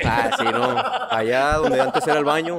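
high squealing laugh through words. Real, not acted. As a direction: laugh while denying it. Laughing denial of joke.

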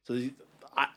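normal talking voice

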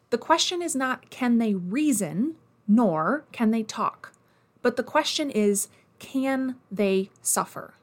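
slow, measured quotation reading; dictating. Retold or imitated. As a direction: read the quote out slowly, word by word. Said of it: "Bentham famously writes, comma, quote".

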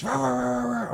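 high barking rah rah; imitated barking. Describing the dogs at the window well, high mock barking. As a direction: bark out the rahs like mad dogs.

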